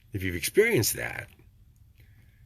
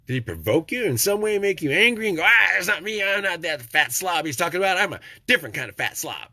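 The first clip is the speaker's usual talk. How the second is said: mock offended viewer; 8 semitones higher, 4 dB louder than usual. Voicing an angry viewer.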